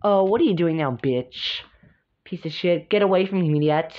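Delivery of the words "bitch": ordinary delivery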